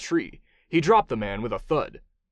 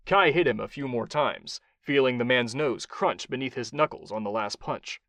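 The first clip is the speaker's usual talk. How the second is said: ordinary delivery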